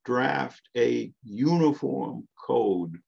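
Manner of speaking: "uniform" drawn out slowly and stressed. Real, not acted. Lecturer naming the committee's task, heavy stress on "uniform". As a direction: lean hard and slow on the word "uniform". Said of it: "I emphasize the word uniform".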